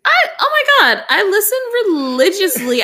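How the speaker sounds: high, loud mock outrage; mock indignant. Real, not acted. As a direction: protest in exaggerated shock.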